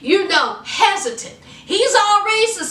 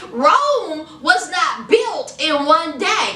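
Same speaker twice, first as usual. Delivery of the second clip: usual speaking voice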